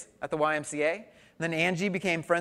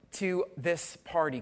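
normal talking voice

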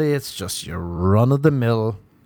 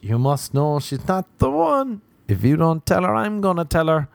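nagging brain; 8 semitones higher than usual. Acted. Brain threatening to tell the girl, high and insistent. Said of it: "your brain starts shouting in your head".

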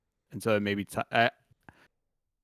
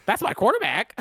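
mock casual; 13 semitones higher, 5 dB louder than usual. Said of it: "He wasn't over there going".

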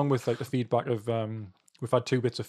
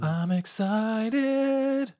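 ghostly wail; 9 semitones higher, much slower than usual. Pretend. Drawn-out spooky voice.

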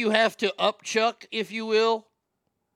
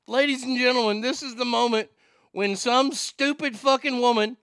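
frantic mock sports commentary; 5 semitones higher than usual. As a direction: call it like a big sports highlight. Mock broadcast of video.

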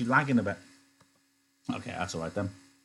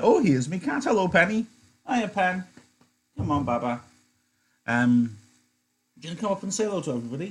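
higher, fond pet-talk voice; talking to cat; 8 semitones higher, faster than usual. Real, not acted. Greeting her cat Penny on stream, fond high pet talk. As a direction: coo at the cat.

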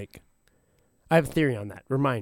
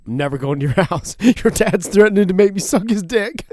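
put-on spooked boyfriend; 7 semitones higher, 7 dB louder than usual. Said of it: "He's like".

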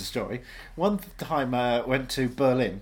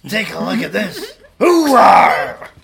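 slow, loud Pacino hoorah; Al Pacino impression; 6 semitones higher, 13 dB louder, much slower than usual. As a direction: bellow like Pacino. Pacino persona.

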